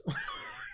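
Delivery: high squeaky giggle; quick, amused, high-pitched laughter. Real, not acted. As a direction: let a soft high laugh slip out. Laughs at own retold threat.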